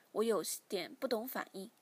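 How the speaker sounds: ordinary delivery, nothing marked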